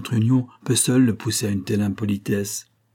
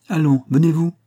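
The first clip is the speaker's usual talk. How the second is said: higher, louder summons; calling out; 6 semitones higher, 5 dB louder than usual. A narrator's reading. Doctor hurrying the others out, calling out. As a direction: urge them along with a raised voice.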